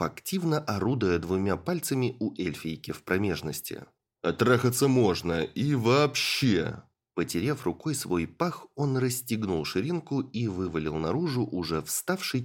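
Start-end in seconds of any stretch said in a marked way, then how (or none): 4.2-6.8 growling bugbear. Acted. Rob continues his retort.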